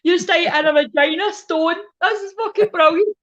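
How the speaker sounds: high, loud, slow mocking cackle of a pal. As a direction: squeal it out high and loud, delighted. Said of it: "she's like".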